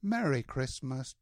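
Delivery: ordinary delivery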